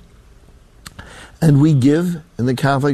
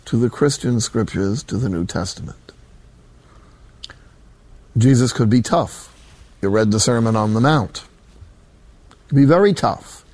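normal talking voice

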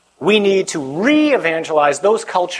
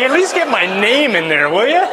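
high-pitched comic protest; mock pleading; 7 semitones higher, 3 dB louder than usual. Priest retelling friend's wish to be named, mock pleading. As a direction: imitate an indignant plea, rising sharply at the end.

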